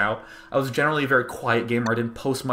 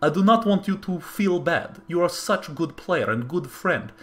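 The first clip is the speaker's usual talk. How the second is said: put-on higher-pitched Russian accent; 6 semitones higher than usual. Russian rival consoling a good friend after the loss, put-on Russian accent. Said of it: "I'll do the Russian accent".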